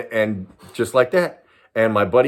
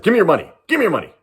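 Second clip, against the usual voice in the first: louder, higher mock robber demand; robber's demand; 8 semitones higher, 4 dB louder, much faster than usual. Gunman demanding money from two teens, mock stern. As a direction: bark it like a stickup man.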